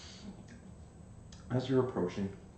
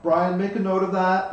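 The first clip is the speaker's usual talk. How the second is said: loud; 7 semitones higher, 9 dB louder than usual. Real, not acted. Player jokes to note-taker.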